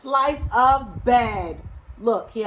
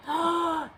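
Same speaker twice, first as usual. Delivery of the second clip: alarmed storyteller's gasp; sharp intake of breath in mock dismay; 8 semitones higher than usual. A narrator's reading. Gasp at the thorny path.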